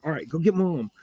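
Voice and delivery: usual speaking voice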